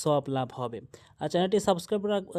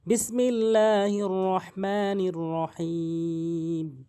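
slow, drawn-out recited bismillah; measured tajweed recitation; Quran recitation; much slower than usual. Acted. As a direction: recite it slowly, stretching the long vowels.

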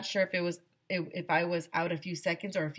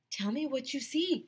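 mild yell; 9 semitones higher than usual. A narrator's reading. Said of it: "Neil yelled".

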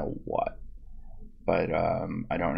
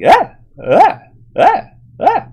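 high, loud wailing screams, rising; hammed-up echoing cries; mock Superman yells; 14 dB louder than usual.

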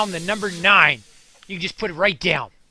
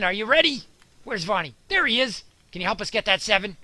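normal talking voice

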